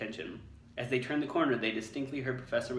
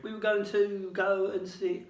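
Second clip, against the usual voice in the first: put-on nervous boy; 9 semitones higher, 5 dB louder, slower than usual. A narrator's reading. Ron caught, stalling.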